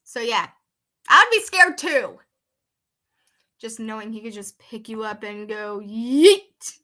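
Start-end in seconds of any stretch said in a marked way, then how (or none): 1.1-2.2 emphatic. Real, not acted. Repeats agreement emphatically. 5.8-6.8 sudden loud shout of yeet. Pretend. Acts out being thrown.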